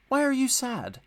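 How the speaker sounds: kind question. A narrator's reading. Driver checking on the sad little engine, gentle and kind.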